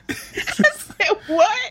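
cracking up mid-quote, high and breathy; laughing. Real, not acted. Retelling a friend's absurd call, laughing helplessly. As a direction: squeak it out through laughter.

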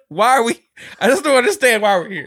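high, loud mock-distressed complaint; retold distress. Stranded passenger complaining on the dark boat, mock distress. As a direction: push it up high in helpless protest.

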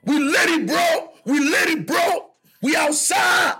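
high, shouted party hype; hyped-up boys. Retold or imitated. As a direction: shout it like friends throwing a welcome party. Boys celebrating his return home.

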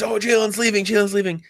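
mocking rumor panic. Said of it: "all these news rumors".

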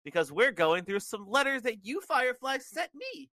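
put-on excited kids'-show host, lilting upward; excited greeting. A narrator's reading. Bray Wyatt welcoming his Fireflies to the Funhouse, excitedly. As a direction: build the excitement, rising high by the end.

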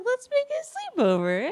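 high, slow hammed-up scheming voice; mock Andy voice. Co-host mocking Andy's sleepover plan, high and slow. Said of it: "He's, he's like".

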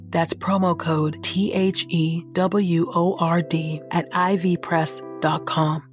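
normal talking voice

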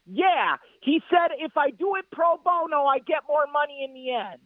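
normal talking voice

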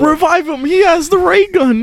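high, strained plea with a cry in it; mock game character. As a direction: ham it up like a panicked game soldier. Quoting a video game line.

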